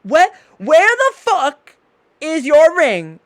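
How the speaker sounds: high, loud, slow demand; outraged knife wife. Acted. Wife seeing his bare hand, outraged high-voiced demand. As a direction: draw out each word, high and furious. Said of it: "and she goes".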